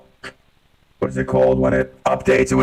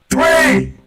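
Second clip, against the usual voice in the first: much higher robotic filtered voice. Real, not acted. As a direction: snap into the robot voice changer. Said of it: "Robot voice on the count of three".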